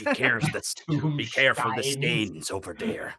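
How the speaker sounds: put-on slow gruff old sailor voice; old sea dog. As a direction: growl it like a weathered fisherman. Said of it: "sounded a bit like the sea captain from The Simpsons".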